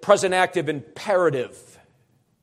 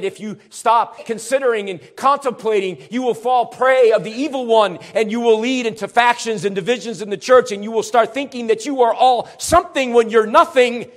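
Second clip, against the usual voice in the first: louder building warning, each clause pushed harder; preaching at full force; 5 semitones higher, 6 dB louder than usual. Real, not acted. Preacher warning his church of the evil one, at full force. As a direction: drive the warning home like a fiery preacher.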